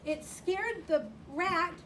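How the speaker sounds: usual speaking voice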